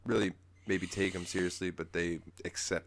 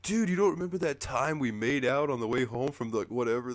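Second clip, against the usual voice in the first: Chandlo; 6 semitones higher, 4 dB louder than usual. Retold or imitated. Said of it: "And he goes".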